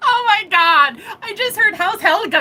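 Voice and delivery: high, loud, laughing disbelief; cracking up. Real, not acted. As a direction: gasp it out high between laughs. Cracking up over the joke.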